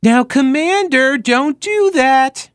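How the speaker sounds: put-on high, drawn-out baby-talk scolding; coddling dog-owner voice. Shown as a demonstration. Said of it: "tone of voice like".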